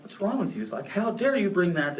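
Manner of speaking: high-pitched mock distraught mother; worked-up imitation of a tearful mother; imitating upset mother. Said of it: "my mother's in crying tears".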